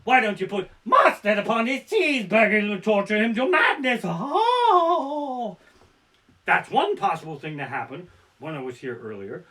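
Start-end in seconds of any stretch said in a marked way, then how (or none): 0.0-4.0 louder, higher villain taunt; hammed-up evil wizard voice; evil Lord Voldemort. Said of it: "the evil Lord Voldemort". 4.0-5.6 high loud wail of despair; hammed-up anguished yell; anguished cry. Anguished cry after story.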